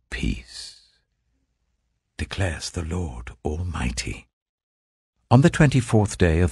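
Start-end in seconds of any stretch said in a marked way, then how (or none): 0.1-1.0 hushed whispered word; whispered softly as a blessing. Acted. The Lord's promise ends softly.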